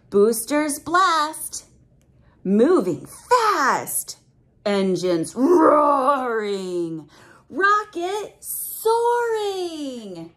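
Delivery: slow, loud, drawn-out chant; dramatic rhyme reading. Acted. Reading the launch rhyme to a child, slow and dramatic. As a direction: roll out each line like roaring engines.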